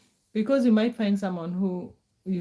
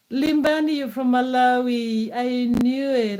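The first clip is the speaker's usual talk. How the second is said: excited guess; 4 semitones higher, 4 dB louder than usual. Real, not acted. Guessing a viewer's country right.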